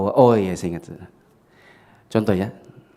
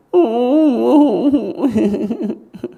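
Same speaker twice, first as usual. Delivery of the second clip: high, loud burst of laughter; laughing fit; 12 semitones higher, 5 dB louder than usual. Real, not acted. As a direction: laugh almost to tears. Laughing while telling the story.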